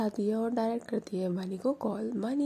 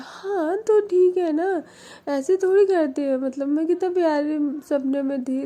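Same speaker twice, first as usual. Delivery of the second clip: high, loud, whiny protest; whiny complaint; 8 semitones higher, 7 dB louder than usual. Acted. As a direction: whine like a spoiled girlfriend. Mani complains about broken dream. (ऊँची, तेज़, शिकायती ठुनक)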